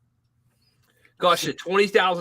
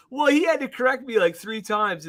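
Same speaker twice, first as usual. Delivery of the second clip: ordinary talk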